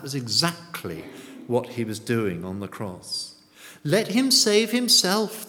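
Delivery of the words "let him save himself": high, loud mock taunt; acted sneer. Onlookers at the cross taunting Jesus, sneering loudly. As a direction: throw it out as a raised jeer. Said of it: "they sneered".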